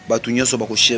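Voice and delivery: ordinary talk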